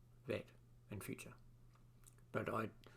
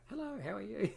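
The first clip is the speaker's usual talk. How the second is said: much higher, sing-song hello; mock cheery greeting; 12 semitones higher, 4 dB louder than usual. Greeting old faces at the club, high and chirpy. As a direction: play an overly chirpy greeter.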